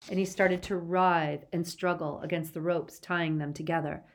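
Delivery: usual speaking voice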